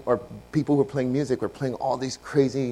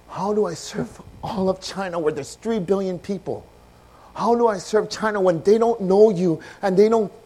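higher, louder broken plea to God; anguished prayer; 9 semitones higher, 9 dB louder than usual. Retold or imitated. Brother crying out to God over China, anguished and loud. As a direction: pour out a helpless cry to God.

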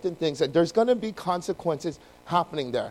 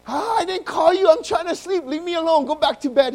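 high, whiny sleepy complaint; exasperated high-pitched old prophet; grumpy woken Eli; 9 semitones higher than usual. Acted. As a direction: whine at being woken up. Acts Eli annoyed at being woken.

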